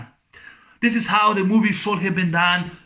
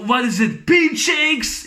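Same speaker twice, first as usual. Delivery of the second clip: high shouted taunt; mock thug shout; 6 semitones higher, slower than usual. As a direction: bark it up high at him.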